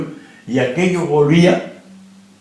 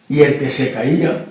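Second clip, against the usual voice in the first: ordinary delivery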